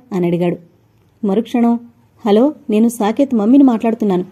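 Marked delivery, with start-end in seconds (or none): none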